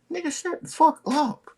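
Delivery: high, cutting him off; high woman's voice. Retold or imitated. Woman cutting off his apology, high-pitched. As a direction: snap it shut in her high voice. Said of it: "quote from her".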